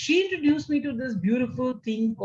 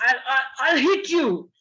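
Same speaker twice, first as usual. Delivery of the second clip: a louder mock threat from a father; scolding father; 3 semitones higher, 5 dB louder than usual. Parent threatens child, mock angry father. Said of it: "Like if your father would say".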